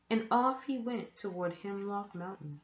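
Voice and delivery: plain reading voice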